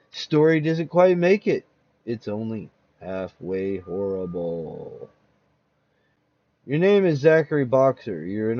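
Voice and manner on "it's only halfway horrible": slow, low and lingering host aside; wry spooky host. Acted. Horror host teasing listeners about the story, slow and low. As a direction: savour it like a ghoulish host.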